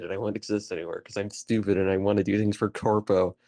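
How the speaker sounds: ordinary delivery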